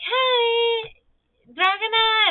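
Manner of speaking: high, drawn-out retold hello; imitating a coworker's high-pitched hello; chirpy greeting. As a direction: sing out the name in greeting. Coworker greets her brightly, retold.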